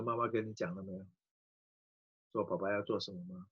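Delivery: gentle hushed father to daughter; tender question. Retold or imitated. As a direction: hold her close and ask softly. Father gently asks crying daughter.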